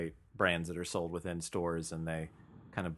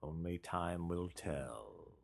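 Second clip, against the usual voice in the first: slow, drawn-out mock-portentous pronouncement; mock-ominous; 7 dB quieter, much slower than usual. Cohost answering an open question, mock-ominous. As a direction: intone it slowly as if it were a grand verdict.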